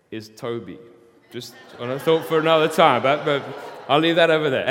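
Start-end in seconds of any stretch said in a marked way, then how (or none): 1.3-4.7 loud aside. Real, not acted.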